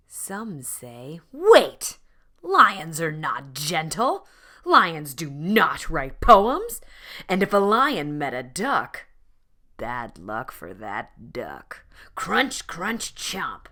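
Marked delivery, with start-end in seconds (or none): 1.3-2.0 very high, very loud cry of wait; shrieking interruption; sudden shout. A narrator's reading. Objecting voices interrupt the story. 2.4-13.7 loud, emphatic storybook objection; hammed-up lecturing about fierce lions; louder protest to the storyteller; indignant loud insisting. A narrator's reading.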